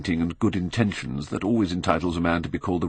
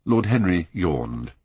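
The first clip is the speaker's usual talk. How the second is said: ordinary delivery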